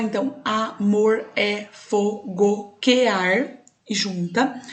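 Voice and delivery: verse read out slowly, syllables joined; slow teacher demo of elision; slow scansion reading. Shown as a demonstration. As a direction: stretch it out and join the vowels. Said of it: "o quear e juntar".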